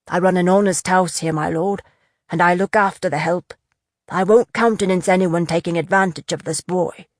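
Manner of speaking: a hint of a sturdy old man standing his ground; protective innkeeper. Innkeeper warns the lord off the boy, firmly.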